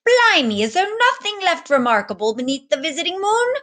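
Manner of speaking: high, loud astonished exclamation; exclaiming. A narrator's reading. Captain exclaiming in surprise at the boy, loud and high. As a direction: burst out in mock amazement. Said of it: "he exclaimed".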